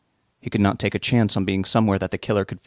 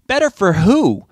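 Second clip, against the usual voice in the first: indignant, much higher and louder; raised voice; 11 semitones higher, 7 dB louder than usual. A narrator's reading. Rob argues back at Rich, voice raised. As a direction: throw the question back, voice raised. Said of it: "Rob's voice was raised as well".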